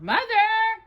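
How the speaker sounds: high call for mother; lost puppy calling Mother. Acted. Lost dog calls out.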